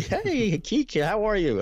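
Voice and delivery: dad breezing in all smiles; cheery dad greeting. Retold or imitated. Dad home from work greeting his wife, cheerfully high.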